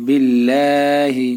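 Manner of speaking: বিল্লাহি with a thin, light lam, drawn out slowly. Shown as a demonstration. Teacher showing students a thin lam in বিল্লাহি, said slow and light. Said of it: "এখানে পাতলা করে বলতে হবে".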